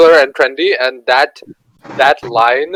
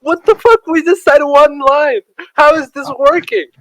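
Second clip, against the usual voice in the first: amazed disbelief; 9 semitones higher than usual. Retold or imitated. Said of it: "and we'd be like".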